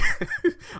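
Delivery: sudden high burst of laughter. Real, not acted. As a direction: crack up mid-review. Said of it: "I'm not laughing because it's funny".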